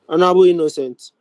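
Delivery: ordinary delivery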